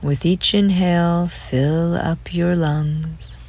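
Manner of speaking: ordinary delivery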